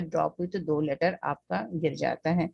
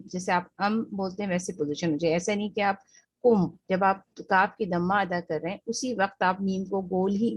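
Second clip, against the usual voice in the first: ordinary delivery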